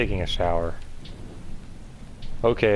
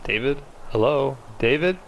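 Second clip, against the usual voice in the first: calling through the house, rising higher each time; 5 semitones higher than usual. Acted. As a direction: mom calling her husband from downstairs, rising call. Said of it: "Mom, why are you yelling?".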